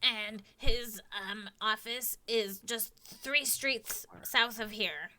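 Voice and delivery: a hint of a squeaky kobold. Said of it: "I can't really do a different sounding kobold voice".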